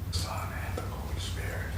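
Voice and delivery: hushed prayer over the cards; whispered prayer. Real, not acted. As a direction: whisper the blessing. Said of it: "so I can pray on it".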